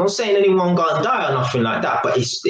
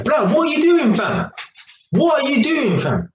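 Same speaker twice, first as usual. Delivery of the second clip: angry confrontation; 9 semitones higher than usual. Retold or imitated. To the man leaning on his car again, a sharp angry demand. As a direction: confront him, head-on.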